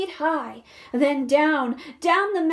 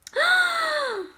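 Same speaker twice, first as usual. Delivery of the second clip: high startled gasp; sharp gasp; 13 semitones higher than usual. Acted. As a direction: gasp in shock. Reader reacts with alarm.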